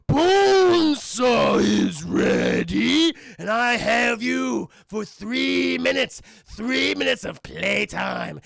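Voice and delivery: very slow, loud growling shout; menacing wrestler. Retold or imitated. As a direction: taunt the opponent like a ring villain. Wrestler voice in retold scene.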